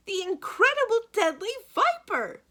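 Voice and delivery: very high, loud shriek of the name; a banker shrieking the snake's name; panicked repeat. A narrator's reading. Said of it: "Mister Poe repeated".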